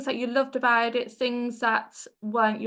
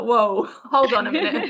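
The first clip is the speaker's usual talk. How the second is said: laughing, louder mock alarm as her overwhelmed brain; flustered laughing; 5 dB louder than usual. Interviewer voicing her own overwhelmed brain, laughing alarm. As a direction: laugh through it like your brain just hit a wall. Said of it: "my brain would just be like".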